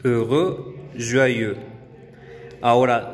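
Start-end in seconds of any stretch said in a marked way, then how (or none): 0.0-1.6 "Heureux, joyeux" with rounded E; French examples. Shown as a demonstration. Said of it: "el diptongo E U".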